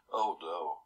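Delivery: ordinary delivery, nothing marked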